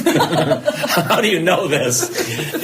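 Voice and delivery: amazed, laughing through "How do you know this?"; laughing disbelief. Retold or imitated. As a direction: laugh while asking, half-mock amazed.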